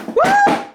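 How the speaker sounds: high, loud whoop; real woo of delight; excited whoop. Real, not acted. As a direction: cheer out of pure joy. Cheering about band news.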